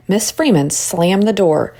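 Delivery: ordinary delivery, nothing marked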